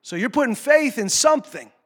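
ordinary talk

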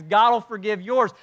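ordinary delivery

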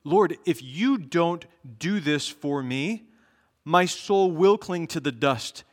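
impassioned prayer cry. Acted. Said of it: "This is the cry of the righteous".

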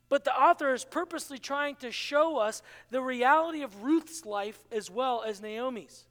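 ordinary talk, nothing marked

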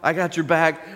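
high, loud laid-back surfer dude; casual dude. Acted. Cupbearer to Joseph, carefree dude promising, high and loud. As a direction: toss it off like an eager buddy. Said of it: "Cupbearer's like".

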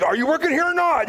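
high, sharp exasperation at idle clerks; exasperated demand. Retold or imitated. Retelling the store, demanding the clerks help, exasperated. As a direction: pitch it up, fed up and demanding. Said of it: "I'm like, like".

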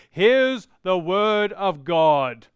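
slow, mock-lordly pronouncement; aloof pastor. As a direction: hand it down slowly, like a pastor from a mighty throne. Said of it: "very disingenuous of, of me as your pastor to say".

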